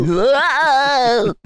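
high, drawn-out surprised whoa; overdone amazed reaction; exaggerated whoa. Shown as a demonstration. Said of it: "That's how I react".

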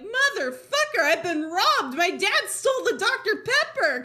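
high, loud comic indignation; hammed-up outraged accusation, pitched high; mock outrage. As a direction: cry out as if robbed, half laughing. Acting out finding the box empty.

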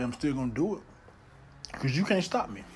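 ordinary delivery

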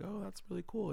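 lightly voiced impressed driver; imitating the driver. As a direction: chirp it up like an easygoing chauffeur. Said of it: "He's like".

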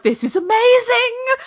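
high, drawn-out gushing exclamation; over-the-top delight. Retold or imitated. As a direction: squeal it slowly, as if overwhelmed. Gushing retold reaction, raised voice.

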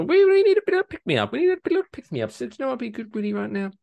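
very high, fast chattering; cartoonish squeal rushing along; silly squeaky voice. Host begging for a pick-me-up, silly squeaky voice. Silly voice introducing feel-good segment.

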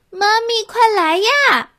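put-on high, childlike call. A narrator's reading. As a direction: call for Mommy to come. Mouse calling for mother.

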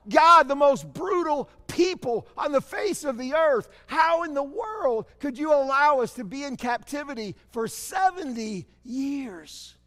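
loud, high, anguished plea to God; Habakkuk crying out. Retold or imitated. Prophet crying to God over captivity, loud anguished plea. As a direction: cry out in despair, slowing at the end. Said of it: "Habakkuk is crying out".

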